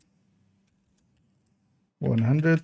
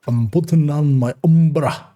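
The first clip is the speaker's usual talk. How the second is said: hammed-up Scottish brogue, louder; Scottish accent; 3 semitones higher, 6 dB louder than usual. Gamer joking to viewers in a mock Scottish accent. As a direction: put on a jokey Scottish accent. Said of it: "say it with, like, a Scottish accent".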